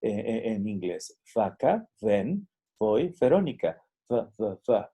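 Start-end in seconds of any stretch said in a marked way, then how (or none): 1.3-3.8 "Vaca", "ven", "voy", "Verónica" said with a Spanish B-like V; Spanish V words. Shown as a demonstration. Teacher shows how V sounds in Mexican Spanish. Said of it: "Noten mi español". 4.1-4.9 "Th, th" said as a held lip-to-teeth sound; labiodental sound. Shown as a demonstration. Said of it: "Labio dental".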